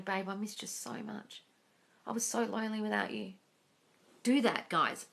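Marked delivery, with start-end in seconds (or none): 0.0-3.3 much softer, intimate murmur; soft voice in the ear. Retold or imitated. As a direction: murmur it close, as if into a lover's ear. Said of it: "hear their voice in your ear saying".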